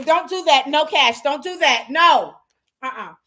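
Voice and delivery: loud firm no at the puppy; scolding dog. Real, not acted. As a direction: shut the biting down, loud. Scolding puppy mid-lesson.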